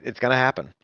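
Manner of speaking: ordinary talk, nothing marked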